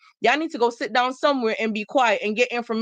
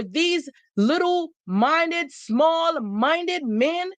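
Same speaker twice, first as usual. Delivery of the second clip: slow scorn; 5 semitones higher, much slower than usual. Real, not acted. Scornful emphasis on men.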